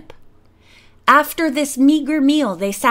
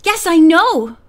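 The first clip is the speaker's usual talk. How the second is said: loud, higher, eager burst; bursting to tell, raised and louder; eager cry; 5 semitones higher, 6 dB louder than usual. A narrator's reading. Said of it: "cried little Georgie eagerly".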